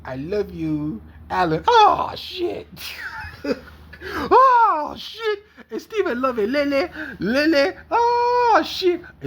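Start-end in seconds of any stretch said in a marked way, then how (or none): 1.7-9.0 very high, loud laughing shrieks. Real, not acted. Cracks up mid-serenade.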